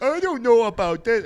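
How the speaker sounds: high, loud babyish imitation; dopey put-on baby whine; mock baby voice. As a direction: play a clueless baby, hammed up. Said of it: "turn you into a baby".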